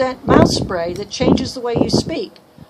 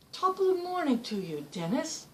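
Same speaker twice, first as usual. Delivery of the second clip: a hammed-up Irish greeting, pitched higher; lightly put-on Irish lilt, softer; mock Irish accent; 4 semitones higher, 10 dB quieter than usual. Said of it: "I think I've got the English spray".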